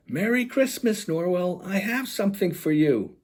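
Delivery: calling out. Acted. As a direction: call to a friend's house. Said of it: "she calls when she reaches".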